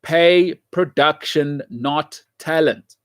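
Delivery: slow, deliberate mantra; slow deliberate catchphrase; slow emphatic. Real, not acted. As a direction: spell out the slogan word by word. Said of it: "I'll keep saying this".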